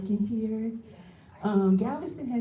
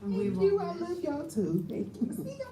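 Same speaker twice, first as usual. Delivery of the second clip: much higher, quieter voice holding back tears; choked up; 10 semitones higher, 3 dB quieter than usual. Real, not acted. Leaving employee saying goodbye to colleagues, choked up. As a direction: squeeze out the goodbyes through tears. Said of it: "And I'm trying not to cry".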